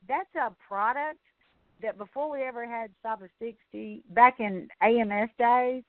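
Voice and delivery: ordinary delivery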